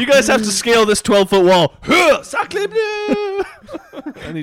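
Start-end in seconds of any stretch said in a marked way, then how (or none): 0.0-1.7 high, loud jokey announcer read; mock announcer. Mock announcer reading challenge. 1.8-3.4 very high shouted French cry; French chef outburst. Pretend. Camille thrown over the wall. 3.5-4.2 breathy high laugh. Real, not acted. Laugh after bit.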